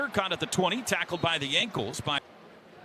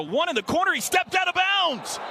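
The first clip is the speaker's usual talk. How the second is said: rising shout as the return breaks; excited call; 12 semitones higher, 4 dB louder than usual. Real, not acted. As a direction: voice climbing into a yell on the sideline step-out.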